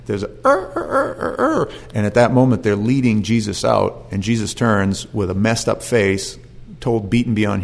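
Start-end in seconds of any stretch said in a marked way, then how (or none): 0.4-1.7 high-pitched mock cock-crow; imitated rooster crow. Preacher imitating the rooster as Peter denies Jesus, high crowing. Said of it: "the rooster's crowing".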